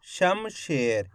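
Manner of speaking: slow soft closing of Shamsher; slow soft dictation. Shown as a demonstration. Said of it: "उच्चारण करो कमलेश, इस तरीके से".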